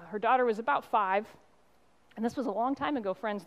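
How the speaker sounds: ordinary talk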